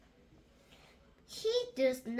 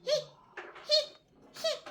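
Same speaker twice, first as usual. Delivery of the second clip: hiccups; 11 semitones higher, faster than usual. Acted. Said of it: "says Big Brother Pig".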